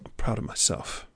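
soft unvoiced whisper to oneself; whispered affirmation. Shown as a demonstration. Listener saying it to themselves, soft whisper. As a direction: whisper it gently as a private promise. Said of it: "Say that to yourself".

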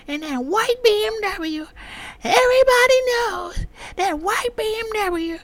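high, loud, slow shrill nagging woman; mock nagging neighbor woman. Neighbor woman scolding a speeding driver, high mocking voice. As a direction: mimic the annoyed neighbor lady. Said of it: "I'm like".

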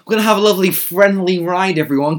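high, mock-jolly rallying voice; annoying chumminess. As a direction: parody an over-friendly trip organiser. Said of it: "it's kind of a bit annoying".

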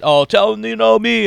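hammed-up name-dropper bragging to friends; mock boastful guy. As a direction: ham up the smug name-dropper. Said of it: "I'm like that guy".

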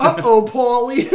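hammed-up gasp of a bro; mimicking Paulie. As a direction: echo the character's goofy uh-oh. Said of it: "that wide-eyed face that he gets".

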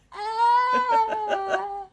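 high crying "ah"; a melodramatic wail; mock sob. Crashing person ending the meltdown with a high crying wail.

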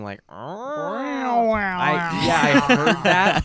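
a mimicked guttural cat noise; guttural cat growl. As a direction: growl it out like an evil cat in the dark woods. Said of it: "Is that really good? Like, you've done that before?".

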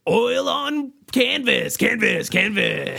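WrestleMania announcer. Acted. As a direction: boom it like a ring announcer. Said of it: "WrestleMania voice".

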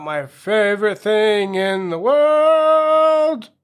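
building to a loud, high, drawn-out "world"; slow mock-grand build, ending on a held high note; hammed-up announcement. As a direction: stretch it out like a big hyped reveal. Mock-grand reveal of episode topic.

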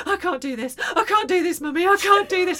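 high, loud tearful mock panic; mock panic attack. Mother imitates daughter panicking over a cut toe, hammed-up wail. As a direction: imitate a child wailing between breaths. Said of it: "in between the not breathing, she's going".

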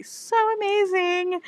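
high, drawn-out gushing; gushing wish. Real, not acted. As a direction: stretch it out with longing excitement. Wishing she could share gossip.

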